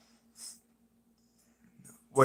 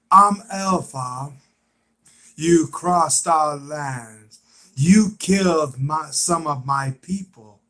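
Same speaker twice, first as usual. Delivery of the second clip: Alpha impression; 5 dB louder than usual. Quoting Alpha's threat over killed people, impression. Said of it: "She's like".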